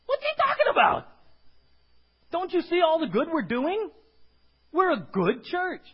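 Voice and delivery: high, loud, offended protest; indignant pastor voice. Retold or imitated. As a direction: play the hurt, busy pastor crying foul. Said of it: "along comes Jesus and says".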